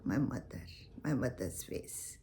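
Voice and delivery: a hint of a child's soft answer; low tender reply. Teacher voicing children answering about mother, soft and low. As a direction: drop low and soft, speaking for every child. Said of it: "All will answer".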